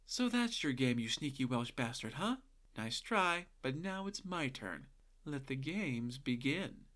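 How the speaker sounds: sneaky hushed taunt to himself; quiet taunt. Acted. Jack whispers his taunt in bed.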